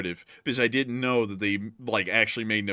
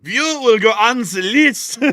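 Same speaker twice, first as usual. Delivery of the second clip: higher, louder, slow, hammed-up German villain accent; mock German accent; 9 semitones higher, 11 dB louder, slower than usual. Co-host's invitation answered with a loud, slow mock German accent. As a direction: bark it like a cartoon German interrogator, slow. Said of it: "what say you?".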